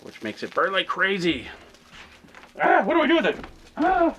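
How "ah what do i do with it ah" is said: high, strained effort voice; straining to twist a stuck lid; opening a stuck jar. Real, not acted.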